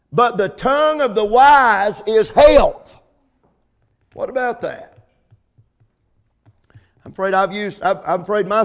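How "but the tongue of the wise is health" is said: slow and loud; preaching scripture. Acted. Preacher giving the verse to the church, slow and loud. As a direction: proclaim it slowly, ringing out. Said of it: "preacher proclaiming scripture".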